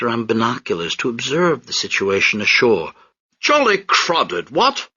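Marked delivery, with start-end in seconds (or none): 3.4-4.9 high, slow upper-class officer drawl; pompous British major. Acted. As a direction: play a self-satisfied colonial officer.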